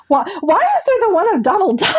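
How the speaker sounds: high-pitched comic disbelief; playful incredulous outburst; mock bewilderment. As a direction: ask it in baffled, rising protest. Baffled joking about tattoos.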